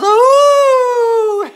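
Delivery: a sudden squealing ooh, way up high and loud; delighted high squeal. Real, not acted. Host squealing at a dancing viewer, delighted high ooh.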